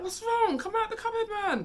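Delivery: high, loud anxious call; pleading with him to come out; worried calling. Retold or imitated. Kids worried, calling to friend.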